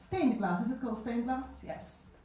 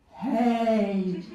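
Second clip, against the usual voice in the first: insistent hey; 6 dB louder than usual. Retold or imitated. Guy on tube demanding attention.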